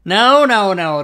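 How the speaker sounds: high, loud no no; loud protest. Real, not acted. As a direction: cry out in protest. Tower collapses, all die.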